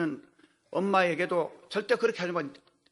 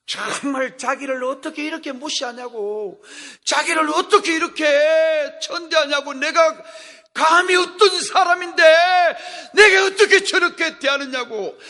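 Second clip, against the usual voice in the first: rising into an offended, louder protest; mock indignant complaint; 6 semitones higher, 9 dB louder than usual. Preacher voicing Naaman's indignation, offended and loud. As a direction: voice a proud man insulted.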